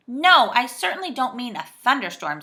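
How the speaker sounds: loud, exasperated groan; a mild groan of impatience. A narrator's reading. Joe corrects the saucepan man, with a groan. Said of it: "said Joe with a groan".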